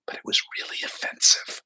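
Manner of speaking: whispered, breathy disbelief; hushed aside. Real, not acted. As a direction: drop to a whisper to stress the offense. Appalled aside about the racist remark.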